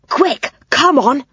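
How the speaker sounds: plain reading voice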